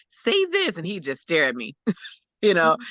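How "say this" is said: retold plea, pitched way up; begging. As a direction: beg a child to speak, high-voiced. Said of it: "trying to beg him to talk".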